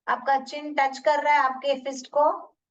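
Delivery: ordinary delivery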